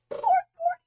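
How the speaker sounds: squeaky high-pitched excited restart; hyped gamer bro. Real, not acted. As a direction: squeal it through laughter. Retake cut by laugh, very high.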